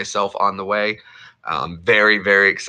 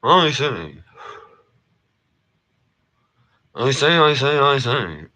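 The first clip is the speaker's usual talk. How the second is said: posh catchphrase; 7 semitones higher, slower than usual. Acted.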